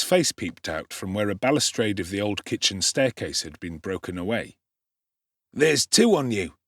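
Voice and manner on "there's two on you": higher, a bit louder gruff doorman; rough challenge. A narrator's reading. Man peering with a candle, challenging. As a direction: challenge the newcomers.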